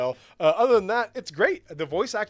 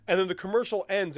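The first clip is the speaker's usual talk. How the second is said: ordinary talk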